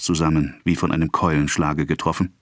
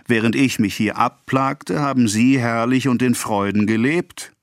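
higher-pitched, mocking reproach; sneering narrated accusation; scornful; 6 semitones higher than usual. A narrator's reading. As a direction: throw it at them with mild scorn. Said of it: "mit einer höhnischen Geste".

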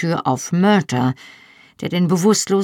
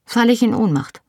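curt, higher-pitched comeback; 8 semitones higher, faster than usual. A narrator's reading. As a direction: answer briefly, pitched up. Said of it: "sagte ich knapp". (knapp, mit höherer Stimme)

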